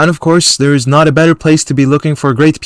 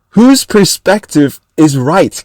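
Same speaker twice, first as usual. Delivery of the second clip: slow, higher, mock-cocky imitation; overblown confidence; 4 semitones higher, 3 dB louder, slower than usual. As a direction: draw it out to mock his cockiness. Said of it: "With, like, overblowing confidence".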